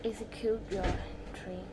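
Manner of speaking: usual speaking voice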